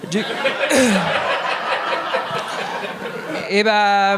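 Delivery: ordinary delivery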